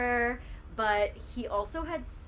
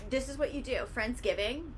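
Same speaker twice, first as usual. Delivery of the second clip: normal talking voice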